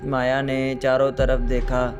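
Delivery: usual speaking voice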